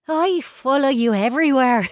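high, loud adoration; gushing fan. A narrator's reading. Brianna confessing she follows the twins, gushing. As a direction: gush it at your idol.

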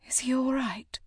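a faint, breathless whisper from the bed; weak whisper. A narrator's reading. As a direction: barely voice it, drained. Exhausted mother asks after baby.

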